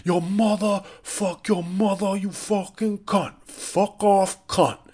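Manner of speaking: put-on atrocious New York accent, high and stretched out; Mike Francesa New York accent. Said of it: "atrocious New York Mike Francesa a-, accent".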